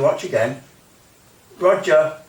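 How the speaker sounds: ordinary talk, nothing marked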